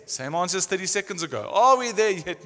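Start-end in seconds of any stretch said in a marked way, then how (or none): 1.5-2.4 put-on whiny kid asking from the back seat; nagging child, louder; pestering child. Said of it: "sounds like kids on a trip".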